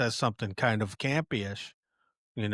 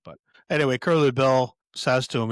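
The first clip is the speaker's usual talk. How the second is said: normal talking voice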